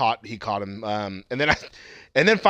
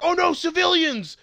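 1 mock Jason; 14 semitones higher than usual. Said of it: "fucking Jason's like".

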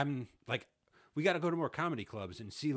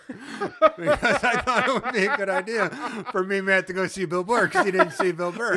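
laughing through it, much higher and louder; 9 semitones higher, 14 dB louder than usual. Real, not acted. To his co-host, laughing at buying more tickets. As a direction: crack up while telling it.

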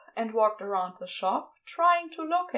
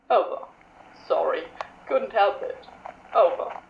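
cheeky Crowley; 3 semitones lower, 4 dB louder than usual. A narrator's reading.

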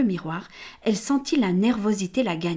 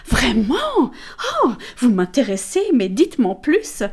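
feigned interest; 3 semitones higher, 5 dB louder than usual. Acted.

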